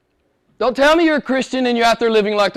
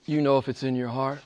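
hushed; 10 semitones lower, 11 dB quieter than usual. Acted. Repeated line, dropped to near hush.

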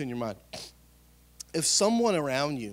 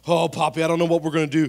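loud, hammed-up panicked whine; panicked teen whine; 3 semitones higher, 9 dB louder, faster than usual. Teen to grandpa about his girlfriend, hammed-up panic. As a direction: wail it like a lovesick teenager. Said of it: "I thought my girlfriend's gonna break up".

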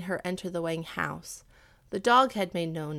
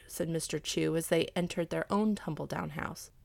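ordinary delivery, nothing marked

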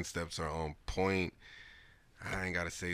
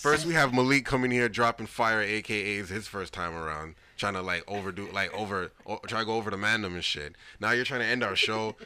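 hyped up; 3 semitones higher, 9 dB louder than usual. Real, not acted. Hyped [name] rant, loud.

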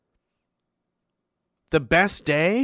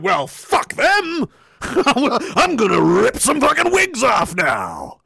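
slow, louder, much higher laughing yell; gleeful shout; 6 semitones higher, 4 dB louder, much slower than usual. Retold or imitated. Recruit vowing to fight the bad guys, slow laughing shout. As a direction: roar it with a fighter's glee. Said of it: "I'm like".

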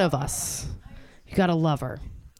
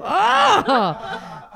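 mock sensual cry; 10 semitones higher than usual.

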